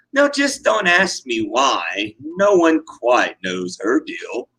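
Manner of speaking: ordinary delivery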